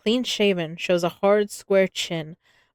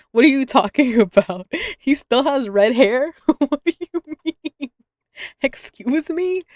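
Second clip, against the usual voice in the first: high, loud disbelieving outburst with laughter; half-laughing, can't believe the description; incredulous; 6 semitones higher, 6 dB louder than usual. Real, not acted. Reader shocked at the doctor's looks, laughing in disbelief.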